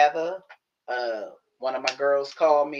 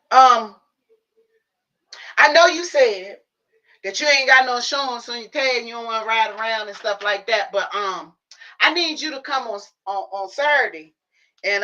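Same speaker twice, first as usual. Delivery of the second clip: higher mock mom voice asking a favor; imitating mom; 7 semitones higher, 4 dB louder than usual. Mom asking daughter for a ride to eyebrow tattoo, higher.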